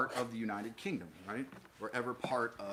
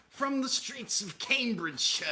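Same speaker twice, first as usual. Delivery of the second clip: high, plummy British businessman caricature; fat Victorian British businessman; 12 semitones higher than usual. Acted. Joking about Cambridgeshire as street turf, British businessman voice. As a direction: do a pompous old British businessman. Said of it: "a fat nineteenth century British businessman".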